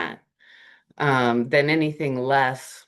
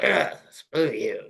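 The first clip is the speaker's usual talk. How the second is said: slow, lightly voiced disgusted grump; a hint of a fed-up comic sneering; slower than usual. Comic opening his set with a slow, fed-up grumble.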